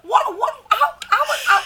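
high, loud, stammering bewilderment; baffled disbelief. Retold or imitated. Host retelling her reaction to the Cats trailer, loud bafflement. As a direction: sputter in laughing shock.

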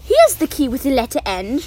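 ordinary delivery

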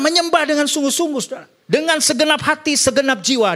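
preaching at full force. Acted. Pastor exhorting the congregation, full-force high preaching. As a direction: lift the church with a soaring sermon.

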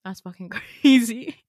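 mad at herself. Retold or imitated. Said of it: "I'm mad at myself".

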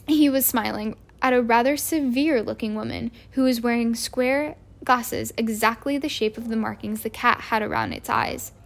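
usual speaking voice

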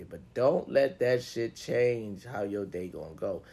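ordinary talk, nothing marked

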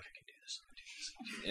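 barely audible whisper; under his breath. Acted. Game master showing what companions overhear, whispered under his breath. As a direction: whisper a nervous mantra only to yourself. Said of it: "you can hear him under his breath".

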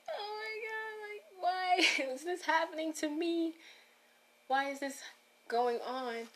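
high, tearful crying voice, reliving the moment; retold crying. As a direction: sob out the questions in a high, breaking voice. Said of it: "I literally started crying".

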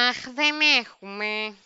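slow, drawn-out apology in a put-on voice; imitated flight attendant. Trolley lady refusing water, slow imitated attendant voice. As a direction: turn her down slowly as the attendant. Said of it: "Περνάει εκεί η κυρία με το τρόλεϊ".

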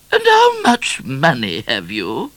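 high, slow, imperious question; regal Queen. A narrator's reading. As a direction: question a subject like a grand queen. Queen questions Midnight in audience.

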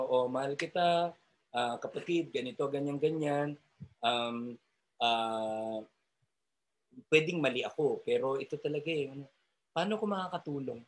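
soft, humble talk to a son; gentle correction. Shown as a demonstration. Parent gently correcting son Casey, soft and humble. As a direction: ease into it softly as a loving parent. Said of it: "O ito, halimbawa".

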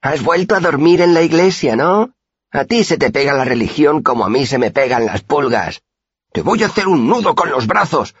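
high, loud taunting insults; insulting quarrel. A narrator's reading. The boys trading insults over religion and mothers, high and loud. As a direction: spit the insults to provoke.

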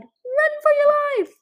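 high, drawn-out shout; yelled warning. A narrator's reading. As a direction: yell it slowly and loudly as an alarm. Captor yells a random warning.

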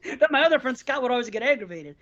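normal talking voice

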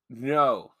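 ordinary talk

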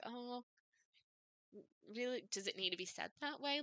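softly, mildly taken aback; quiet, hesitant retold surprise. New mother reacting to a curt midwife, mildly taken aback. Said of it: "kind of taken aback".

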